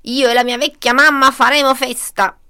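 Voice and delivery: loud villainous glee; gloating fox. A narrator's reading. Fox plans a feast with his mother, gleeful. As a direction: crow loudly about the feast. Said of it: "si rallegrò la volpe".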